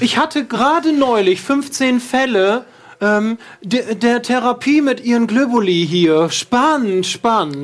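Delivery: doctor raving about the globuli cases, high and slow. Acted.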